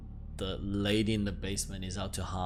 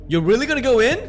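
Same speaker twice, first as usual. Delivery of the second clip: high, loud disbelieving squeal; incredulous alarm; 13 semitones higher, 11 dB louder, faster than usual. Acted. Player balking at entering the basement, high incredulous alarm.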